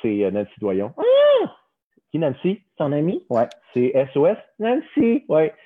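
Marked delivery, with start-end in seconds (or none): 1.0-1.6 high excited puppet squeal. Acted. Puppet Gustave squealing on seeing Nancy. 4.6-5.2 squeaky puppet asking who; high little puppet voice, curious. Acted. Puppet Gustave curiously asking who is there.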